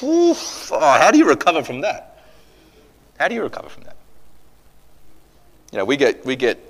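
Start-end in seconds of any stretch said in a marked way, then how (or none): 0.0-0.7 high, blown-out exhale; acted gasp of dismay; winded oof. Reacts to imagined look from Christ. 0.7-2.2 high, aghast rhetorical question; voiced-out disbelief; pained exclamation. Exclaims at Peter's failure, then repeats softly. 3.2-4.0 high, soft, stunned question; quietly aghast; hushed repeat. Acted. Same question repeated softly.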